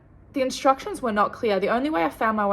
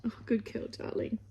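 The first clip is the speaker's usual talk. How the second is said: hushed, almost whispered praise for the dog; soft pet talk; 11 dB quieter than usual. Real, not acted. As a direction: coo gently to the dog. Talking softly to her dog.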